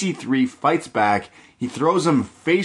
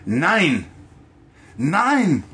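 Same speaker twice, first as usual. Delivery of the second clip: 1 shouted German; 6 semitones higher than usual. Retold or imitated.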